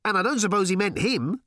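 high, calling aside; shouted. A narrator's reading. Ford adding a doubt for Arthur, shouting. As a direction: keep calling over the hooves. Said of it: "shouted Ford".

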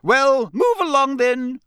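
loud, high, drawn-out command; stern professor directing pupils; sharp order. Acted. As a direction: herd the crowd along briskly in tone. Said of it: "said Professor McGonagall sharply".

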